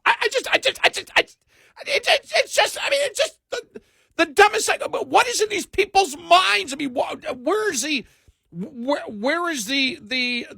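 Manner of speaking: high shouting, near-crying outrage; escalating comedy rant. Retold or imitated. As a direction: rant building to a squeal. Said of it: "really trying to do the impression of him".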